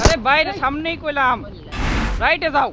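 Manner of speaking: loud, slow, exasperated yelling; frustrated shout. Real, not acted. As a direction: scold them for not listening. Frustrated at teammates in fight.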